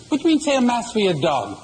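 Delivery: mock outrage. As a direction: play the outraged priest, lightly. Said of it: "Father's indignant, he's outraged".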